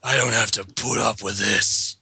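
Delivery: ordinary talk, nothing marked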